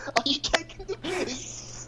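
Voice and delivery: high, slow mock British sneer; drawn-out fake British "taking the piss"; British accent. Echoing British phrase.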